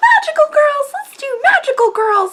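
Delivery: high, eager pitch; excited. Retold or imitated. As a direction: gush like a giddy writer. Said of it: "And they're just like".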